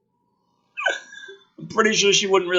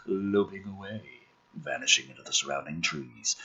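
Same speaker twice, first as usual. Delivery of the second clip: dramatic script reading; 6 semitones lower than usual. Acted.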